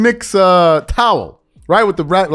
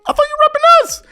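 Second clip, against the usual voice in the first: high, put-on voice of an upset fan, much higher; mock betrayed Laker fan, pitched way up; whiny Laker fan; faster than usual. Mocking upset Laker fans.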